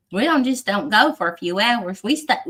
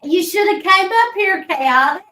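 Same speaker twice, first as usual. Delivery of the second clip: calling out; 10 semitones higher, 5 dB louder than usual. Real, not acted. Calling out to a friend.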